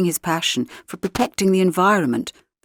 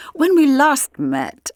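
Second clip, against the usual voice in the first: casually; 7 semitones higher, 3 dB louder, much slower than usual. A narrator's reading. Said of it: "I began casually".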